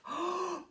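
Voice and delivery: soft awed intake of breath; storyteller's amazed gasp; delighted gasp. A narrator's reading. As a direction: gasp in wonder at a splendid gift. Narrator marvels at beef.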